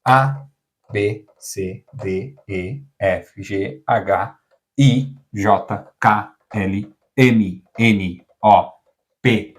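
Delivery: each letter said the Portuguese way, one by one; Portuguese alphabet. Shown as a demonstration. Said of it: "the whole alphabet in Portuguese".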